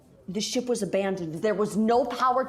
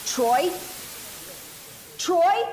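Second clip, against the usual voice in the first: calling out, rising alarm; 8 semitones higher than usual. Acted. Calling lost crewmate, rising alarm.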